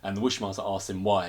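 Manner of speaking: normal talking voice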